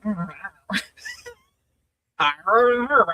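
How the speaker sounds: mock sulky teenager grumble; lightly imitated teenage grumbling. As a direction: mumble it like a teenager. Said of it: "the kind of teenager".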